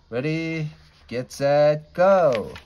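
high, loud race-start shout; starter's call. A narrator's reading.

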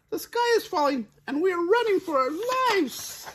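very high, loud, fast squeal; frantic Chicken Little. A narrator's reading. Chicken Little warns the fox, fast high squealing. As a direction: race through it in squeaky panic. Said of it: "quite out of breath".

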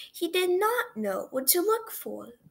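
plain reading voice

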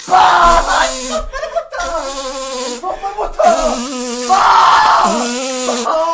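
high, loud mock crying; hammed-up wailing. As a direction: wail for your mother in mock despair. Wailing after being spat on.

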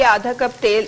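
ordinary talk, nothing marked

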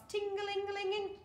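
high, ringing sing-out; bell imitation. A narrator's reading. Bell on the counter going crazy, high ringing imitation.

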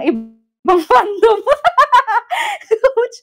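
breaking into high laughter mid-phrase; laughing. Real, not acted. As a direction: crack up on the last word. Cracks up while tattling to coach. (natatawa habang nagsusumbong)

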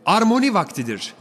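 high, loud call to the crowd; old man shouting. A narrator's reading. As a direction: announce it to the hall, high and loud. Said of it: "diye bağırdı ihtiyar". (kalabalığa yüksek sesle bağıran yaşlı adam)

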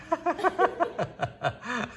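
high, helpless laughter; long laughing fit. Real, not acted. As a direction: burst out laughing at the protest. Amused at the child's protest. (cười nắc nẻ)

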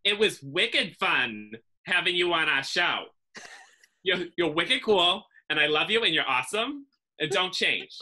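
slow, lightly done Boston accent, wicked fun; a hint of Massachusetts drawl, slowed down. Shown as a demonstration. Guest asks for an outro in her Massachusetts accent, light Boston accent. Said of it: "how would you say ... in that accent".

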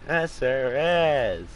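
loud, high, drawn-out retort; emphatic insistence. Real, not acted. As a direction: stretch it out slowly, insisting. Arguing the game really exists.

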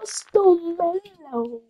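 high, slow fake sob over bad luck; mock crying wail. Player fake-sobbing after landing on a bad square, high slow mock wail. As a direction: whine it like a hammed-up cry.

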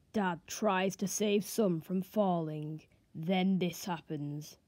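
low, slow and quiet, like a movie trailer; ominous trailer voice. Retold or imitated. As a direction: drag out each word in a dark whisper-quiet voice. Reads clickbait video title.

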